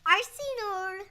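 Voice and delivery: excited shout. Real, not acted. Child shouts pick.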